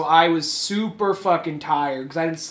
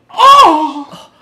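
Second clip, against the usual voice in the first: high, loud scandalized "Oh!"; offended gasp; 11 semitones higher, 15 dB louder than usual. Retold or imitated. Offended lady gasping at the swearing, high loud gasp. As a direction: clutch your pearls.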